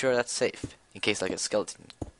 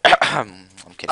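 loud joking; 11 dB louder, slower than usual. Real, not acted. Jokes after asking.

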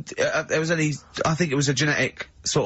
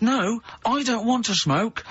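high, slow, drawn-out mock protest; retold protest, pitched right up and stretched; exasperated retort; 9 semitones higher, slower than usual. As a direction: spell it out in exaggerated exasperation. Retold row, repeated with exasperation.

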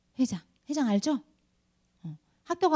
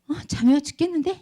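higher-pitched mock child whine; whining sleepy child; 7 semitones higher, faster than usual. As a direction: imitate a grumpy child being kept awake. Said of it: "하면서 막 울더라고요". (졸려서 투정하는 아이 흉내)

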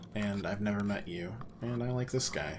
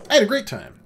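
sarcastic cheer; 11 semitones higher, 11 dB louder, faster than usual. Acted. After uncomfortable dinner, sarcastic.

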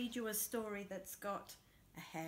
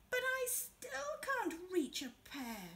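higher, eager little Hare; storyteller's higher Hare voice; Hare's voice; 9 semitones higher, slower than usual. Acted. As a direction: make Hare bright and clever, pitched up. Hare complains.

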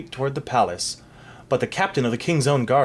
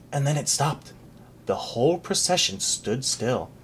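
plain reading voice

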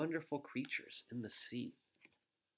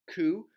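dove coo; 6 semitones higher, 7 dB louder than usual. Shown as a demonstration. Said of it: "make some bird sounds".

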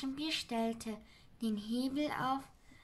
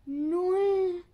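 higher, loud creature count; Sams counting; 7 semitones higher, 10 dB louder than usual. Acted. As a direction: call the number out brightly as the Sams. Sams zählt letzten Punkt. (Sams zählt laut und hoch)

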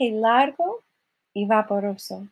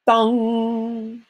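ringing, held bell imitation; clock chime. Narrator imitating the midnight clock, resonant bong. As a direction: strike it and let the hum ring.

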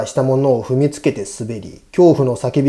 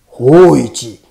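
loud, commanding call of the name; deep voice calling; 7 dB louder than usual. A narrator's reading. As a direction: call out the name like a ghostly samurai. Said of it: "太い声が呼んだ".

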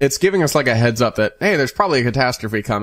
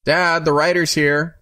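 calls out slowly into the house, pitched up; a boy calling his dad from the door; hollering; 6 semitones higher, slower than usual. A narrator's reading. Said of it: "hollers into the house".

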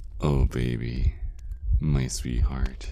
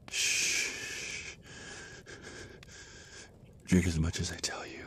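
fast, softer whispered command, a touch higher; menacing whisper; 4 semitones higher, 10 dB quieter than usual. Acted. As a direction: hush them into drinking, coaxing and cold. Vampire coaxing victim to drink.

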